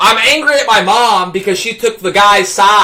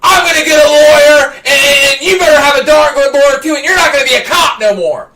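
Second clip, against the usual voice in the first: loud, high defiant shout; puffed-up threat; 5 semitones higher, 4 dB louder than usual. Retold or imitated. Eleven-year-old threatening the cop, loud defiant shout. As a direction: square up and threaten him. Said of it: "puff up my eleven-year-old chest".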